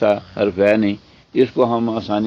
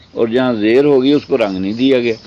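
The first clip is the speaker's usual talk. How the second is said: normal talking voice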